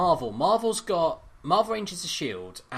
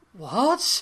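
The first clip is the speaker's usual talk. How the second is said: shocked what; 8 semitones higher, 4 dB louder than usual. Retold or imitated. Shocked reaction retold.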